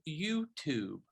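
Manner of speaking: softly imitated Persian accent. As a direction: quote her in a light Persian accent. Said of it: "with her lovely accent, she said".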